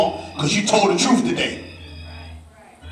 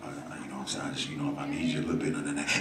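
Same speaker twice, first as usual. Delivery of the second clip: much softer, lower, intimate talk; sincere quiet; 12 semitones lower, 13 dB quieter than usual. Real, not acted. Pastor stepping back to speak sincerely to the room, soft. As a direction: lean in and speak it plainly to them. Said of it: "try to say this without, with all sincerity".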